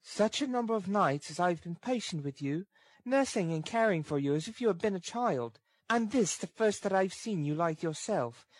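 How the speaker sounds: put-on high girl's voice, gently pleading; sweet-toned, patient reproach from a girl; tender, sweet. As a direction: coax tenderly in a high register. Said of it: "something like sweetness of tone".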